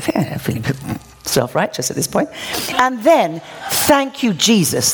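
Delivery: ordinary talk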